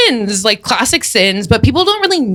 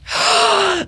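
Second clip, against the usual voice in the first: sharp audible intake of breath; gasp; 8 semitones higher, 4 dB quieter than usual. Pretend. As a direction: gasp at the last sin. Reacts to the last sin.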